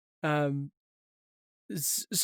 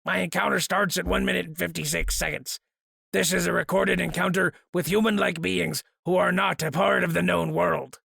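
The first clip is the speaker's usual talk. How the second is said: gruffled mountain man voice. Pretend. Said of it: "gruffled mountain man voice".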